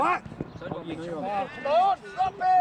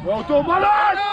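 shouting; 4 semitones higher, 7 dB louder, slower than usual. Real, not acted. Coach calling from sideline.

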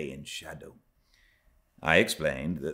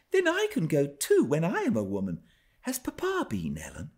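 put-on much higher, fast, eager child voice; eager young Cathy; 11 semitones higher than usual. A narrator's reading. Cathy presses Ellen about the hills, high eager voice. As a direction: rush it out like an excited little girl. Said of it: "she cried gleefully".

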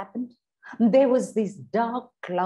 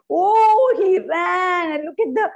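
higher, louder cry of excitement; excited storytelling; 7 semitones higher, 4 dB louder than usual. Shown as a demonstration. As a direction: shout it out like breathless story drama. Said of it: "you can say".

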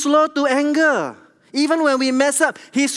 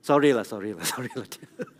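soft apology; 13 semitones lower, 7 dB quieter, faster than usual. Retold or imitated. Dad apologising to crying daughter.

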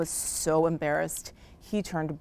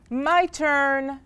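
high, drawn-out eager shout; 7 semitones higher than usual. A narrator's reading. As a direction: call out to claim the turn. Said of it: "shouted Hippo".

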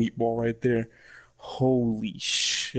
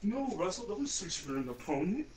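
much softer, higher begging through pain; 7 semitones higher, 11 dB quieter than usual. Real, not acted. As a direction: beg it to stop, breath held. Begging the cramp to stop.

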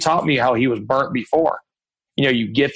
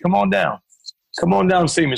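higher, warm call to come over; imitating Les inviting; 6 semitones higher than usual. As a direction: invite him like a booming mentor. Voicing Les calling him over.

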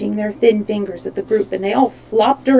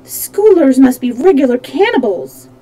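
higher, louder, alarmed realisation; raised voice, a touch of dread; trembling alarm; 4 semitones higher, 6 dB louder than usual. A narrator's reading. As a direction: exclaim it with mild fright. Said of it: "trembling a little".

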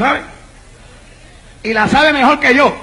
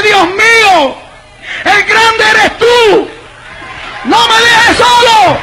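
slow, high, drawn-out plea to God; impassioned preacher acting out a desperate prayer; desperate prayer cry; 7 semitones higher, much slower than usual. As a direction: cry out to God, stretching the words. Cries out to God, retold.

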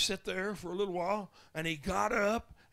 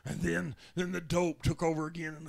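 ordinary talk

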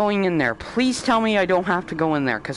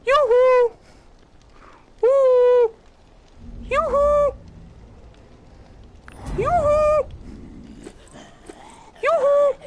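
high singsong call; lilting falsetto yoo-hoo; sing song yoo hoo; much slower than usual. Acted.

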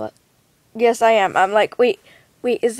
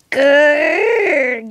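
playful growl; 3 semitones higher, 6 dB louder than usual. Acted.

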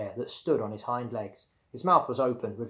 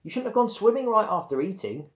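higher-pitched chiding reminder; reminding; 9 semitones higher, 4 dB louder than usual. A narrator's reading. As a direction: remind with a touch of reproach. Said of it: "Bradley reminded her".

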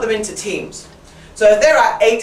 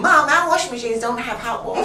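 lightly voiced child, pitched up; a higher voice, pleading to mom; complaining child; 5 semitones higher than usual. Acting out kids complaining at home.

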